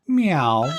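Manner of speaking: high, loud rising meow; high cat meow. A narrator's reading. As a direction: mew like a stray cat. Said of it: "said the cat".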